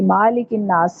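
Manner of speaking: मालिकिन नास with a long 'maa'; wrongly lengthened vowel. Retold or imitated. Teacher shows a common mistake, long 'maa'. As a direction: stretch the first syllable of मालिकिन नास. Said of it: "ये गलती common थी".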